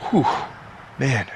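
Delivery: normal talking voice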